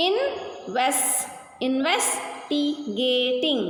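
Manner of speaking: "investigating" slowly broken up, pausing between parts; teacher's drawn-out split of "investigating"; syllable by syllable. Shown as a demonstration. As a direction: sound out "investigating" in slow, separate chunks. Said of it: "Three syllables".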